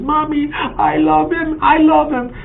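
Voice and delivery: put-on high, slow little-girl voice. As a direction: coo it like a happy toddler hugging a cat. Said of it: "She was holding it and says".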